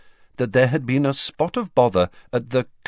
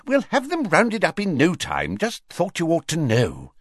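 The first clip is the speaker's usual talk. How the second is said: high, fussy official voice; Fudge voice; 9 semitones higher than usual. Acted. Fudge answering the Prime Minister in a high fussy voice. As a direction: play him as a flustered minister.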